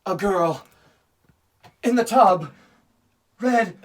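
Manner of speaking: high, loud, dramatic psychic wail. Retold or imitated. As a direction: build to a shrill vision. The psychic escalates.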